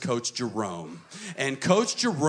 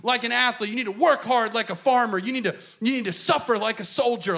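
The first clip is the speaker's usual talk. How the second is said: much higher, louder drill-coach urging; coach pep talk; 10 semitones higher, 6 dB louder than usual. Retold or imitated. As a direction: bark orders like a coach. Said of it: "He's like".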